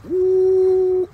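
high, loud startled ooh; startled yelp as the buffalo comes close. Real, not acted. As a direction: jolt back with a yelp.

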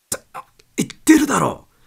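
furious loud outburst; angry shout. Acted. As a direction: blow up in frustration. Wilson loses temper.

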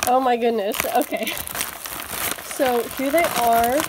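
loud, thrilled burst of delight; real loud excitement, caught up in the unboxing; really loud. Real, not acted. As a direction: let it out loud over the toys. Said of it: "Sorry if it's also really loud".

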